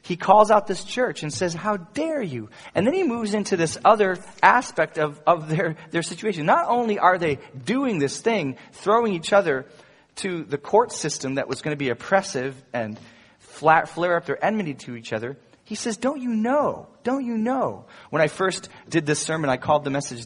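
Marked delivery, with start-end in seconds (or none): 1.5-2.5 higher-pitched scolding quote; indignant telling-off; strong scold. Retold or imitated. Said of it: "really, really strong scold". 16.0-17.9 high, incredulous reproach; preacher voicing an apostle's reproach; rebuking question. Voices Paul rebuking the church.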